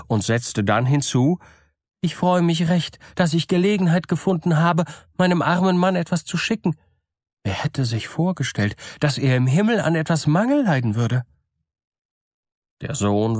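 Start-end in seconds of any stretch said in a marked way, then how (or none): 2.0-11.2 lightly voiced eager simple woman, higher; eager to hear of her dead husband; gullible woman's voice. A narrator's reading. Mother tells her son. (gutgläubige Frau, eifrig und höher)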